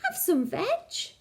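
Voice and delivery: slow, odd hooting duckbill voice. A narrator's reading. Duckbill offering Drip water weeds, slow creature hoot. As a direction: offer it like a friendly creature, slowly. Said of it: "and they hooted".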